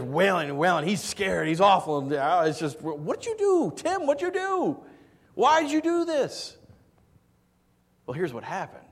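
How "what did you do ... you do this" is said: high, loud, slowing at the end; upset demand. Retold or imitated. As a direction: confront Tim, alarmed and indignant. Family upset at the yelling father.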